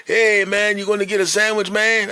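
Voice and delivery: high, loud, friendly push; eager shop owner. Retold or imitated. Owner urging old customer to get sandwich, high and eager. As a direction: press him warmly to eat.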